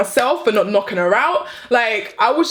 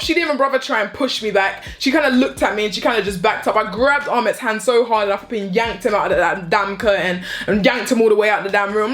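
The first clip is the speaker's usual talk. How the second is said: normal talking voice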